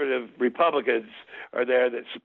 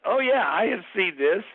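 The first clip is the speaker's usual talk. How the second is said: retold eureka, pitched up; dawning recognition; 7 semitones higher than usual. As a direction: realize you've seen it before. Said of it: "And I'm like".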